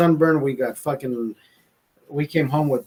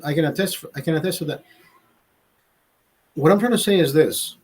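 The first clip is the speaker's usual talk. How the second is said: normal talking voice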